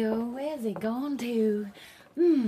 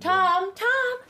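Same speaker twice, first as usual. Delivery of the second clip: louder, higher call across the house; 10 semitones higher, 5 dB louder than usual. Acted. Mother calling Tom across the house about supper. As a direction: call her son from another room.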